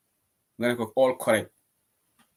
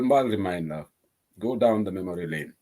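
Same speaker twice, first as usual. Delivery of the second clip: normal talking voice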